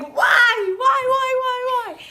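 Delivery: high, loud repeated mock shriek; frantic wailing. Screaming at herself in her mind, frantic wail. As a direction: wail it over and over in hammed-up despair.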